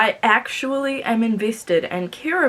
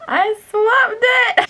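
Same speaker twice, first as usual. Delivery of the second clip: high, loud, slow crying wail; panicked wail; 6 dB louder, slower than usual. Real, not acted. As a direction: wail it out in real distress. Panic putting in new earring.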